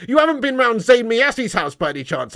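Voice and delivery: ordinary talk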